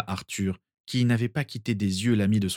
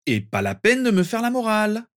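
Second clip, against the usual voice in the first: much higher and louder, cutting off protests; raised voice; 12 semitones higher, 4 dB louder than usual. A narrator's reading. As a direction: raise it over everyone's objections. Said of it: "en élevant la voix". (élever la voix pour couvrir les protestations)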